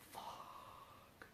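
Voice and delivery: a breathless whispered curse; stunned whisper. Retold or imitated. Told her sister was hit, a whispered curse.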